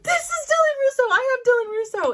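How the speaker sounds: loud, very high-pitched cry; frantic claiming. Retold or imitated. Mom answering the last boarding call, frantic. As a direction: shout it shrilly to stop them leaving.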